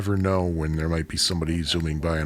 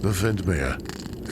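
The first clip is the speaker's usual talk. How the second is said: slow mock German, "Windmere" said as Vindmeah; bad German accent; much slower than usual. Attempting Thirty Rock's Windmere in a clumsy German accent. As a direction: drag out "The Windmere" with a German v and dropped r. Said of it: "I can't do a German accent".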